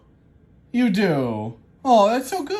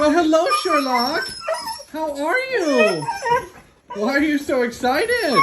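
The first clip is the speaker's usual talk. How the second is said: dog greeting voice; 8 semitones higher than usual. Real, not acted. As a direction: coo up at the dog.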